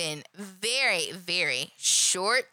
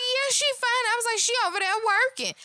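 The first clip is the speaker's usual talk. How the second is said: squeaky high voice, much higher; mock high voice; 13 semitones higher, 3 dB louder, faster than usual. Coworker brushing off the boss's check-in, high and mocking. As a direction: brush off the boss in a silly high voice.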